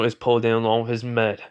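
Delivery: usual speaking voice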